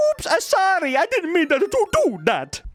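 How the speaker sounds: high, loud silly apology; mock apology. Mario apologizing after crushing someone, high silly voice. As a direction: squeak out a guilty sorry.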